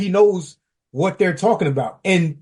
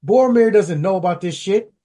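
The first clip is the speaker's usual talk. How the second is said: ordinary delivery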